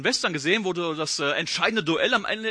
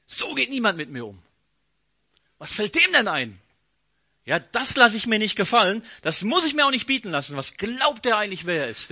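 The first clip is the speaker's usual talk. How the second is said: rising offended rant, getting louder; indignant outburst; 3 semitones higher, 4 dB louder than usual. Retold or imitated. Naaman fuming at being snubbed by the prophet, indignant and loud. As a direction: bristle with wounded pride. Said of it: "wie hättest du getickt".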